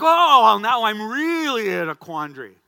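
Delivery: loud, high mock-exasperated outburst; exclaimed inner dismay. Pastor caught between his wish and guilt, loud mock dismay. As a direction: burst out as if caught in a bind. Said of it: "And I was like".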